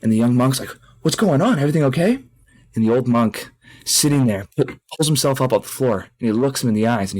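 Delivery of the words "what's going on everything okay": high, concerned mock questioning; lightly acted alarm; worried question. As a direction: check on someone in distress, pitch rising. Young monk finds old monk sobbing.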